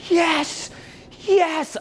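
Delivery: very high squeaky entranced voice; squeaky awed stranger. Retold or imitated. Stranger answering the mystic, squeaky high falsetto. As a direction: play a starstruck stranger in falsetto. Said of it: "They're like".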